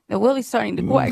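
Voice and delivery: "really" said as "weally", "quack" for "crack"; R softened to W in "really"; imitated speech impediment. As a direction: mimic someone turning R into W. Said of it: "she has a speech impediment".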